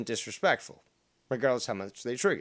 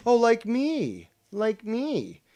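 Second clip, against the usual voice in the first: mock surprise; 10 semitones higher, 4 dB louder, much slower than usual.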